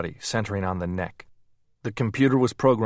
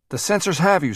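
high, loud sudden warning; alarmed cry; 10 semitones higher, 6 dB louder than usual. A narrator's reading. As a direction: warn him in alarm. Alarm as sensors detect Hall.

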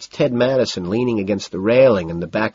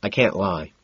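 usual speaking voice

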